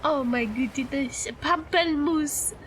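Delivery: lingering mock French accent; horrible French accent. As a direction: savour it in a silly French accent. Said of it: "The horrible accent".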